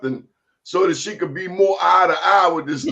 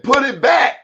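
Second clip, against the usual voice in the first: higher, louder command from auntie; a firm auntie ordering; stern auntie; 8 semitones higher, 4 dB louder than usual. Retold or imitated. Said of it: "she said".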